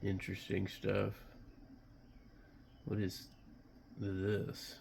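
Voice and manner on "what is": usual speaking voice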